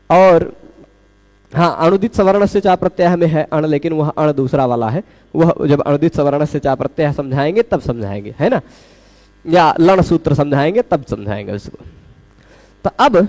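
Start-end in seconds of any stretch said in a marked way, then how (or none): none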